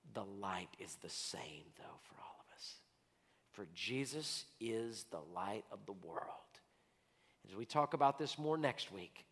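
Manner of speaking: soft, low, half-whispered invitation; hushed altar call. Acted. Pastor's closing invitation to the congregation, hushed and soft. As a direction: draw them close with a hush.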